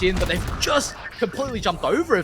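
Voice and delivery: excited play-by-play call; esports caster. Acted. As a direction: ride the rising action. Caster rising with the action.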